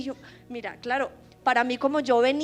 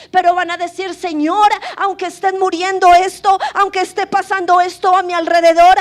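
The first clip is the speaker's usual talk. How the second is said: preaching at full force, much louder; predicación a todo pulmón; 5 semitones higher, 13 dB louder than usual. Acted. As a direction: proclaim it like a fiery preacher over the music. Preaching loudly with music. (predicadora proclamando con fuerza y en voz muy alta)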